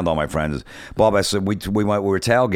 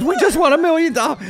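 very high, excited squeal; sister-in-law calling friends, overjoyed; thrilled announcement; 4 dB louder than usual. Retold or imitated. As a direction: gush it into the phone. Wife telling friends on phone.

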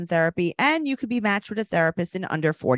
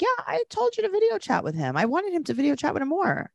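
mimicking Kaya; 10 semitones higher than usual. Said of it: "Tieza is like".

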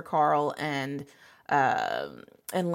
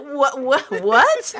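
stammered what, higher and louder; loud incredulous double take; mock disbelief; 7 semitones higher, 8 dB louder, much slower than usual. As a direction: react in exaggerated, baffled shock. Mock disbelief at retold plot.

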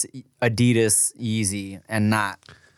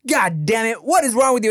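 angry dad yell; 8 semitones higher than usual. Retold or imitated. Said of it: "his dad looked at him and was like".

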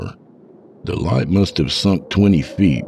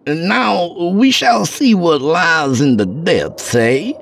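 much higher, drawn-out theatrical voice; high, slow ominous scholar; 4 dB louder than usual. Acted. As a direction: savour each word like a showman. Narrator voices scholar Chabok.

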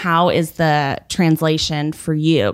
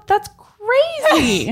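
mock outrage; 13 semitones higher, much slower than usual. Exaggerated disbelief.

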